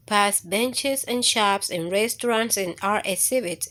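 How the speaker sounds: plain reading voice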